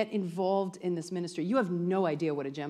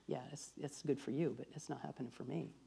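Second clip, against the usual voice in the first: mild resigned sigh; 5 semitones lower, 12 dB quieter than usual. Retold or imitated. Voicing a resigned person.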